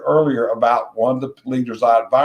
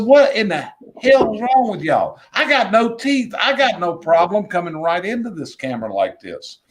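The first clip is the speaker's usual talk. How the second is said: exasperated scolding; 6 semitones higher than usual. Retold or imitated. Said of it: "And I said".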